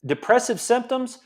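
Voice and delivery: ordinary talk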